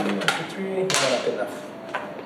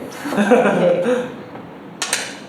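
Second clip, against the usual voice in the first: laughing; 5 semitones higher than usual. Real, not acted. Laughing at his own impression.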